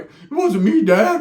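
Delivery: high, loud whiny denial; mock guilty kid. Kid caught by his dad, high loud whiny denial. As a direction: plead innocence like a caught child.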